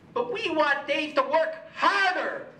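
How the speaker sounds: ordinary talk, nothing marked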